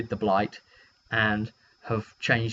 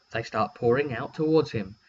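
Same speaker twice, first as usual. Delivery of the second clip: normal talking voice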